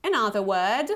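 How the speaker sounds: ordinary delivery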